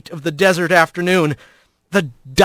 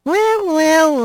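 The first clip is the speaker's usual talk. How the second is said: high, sly drawn-out greeting; a playful tease from the shadows; teasing female voice; 10 semitones higher than usual. Acted. Blaze greets Rat from the trees, teasing.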